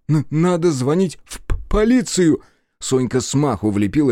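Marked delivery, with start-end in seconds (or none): 0.1-2.4 higher, louder, stuttering fear; frightened partner pleading to call police; panicked stammer. Acted. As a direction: stumble over the words in panic.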